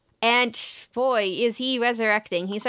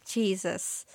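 normal talking voice

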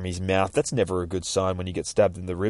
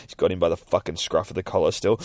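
ordinary delivery

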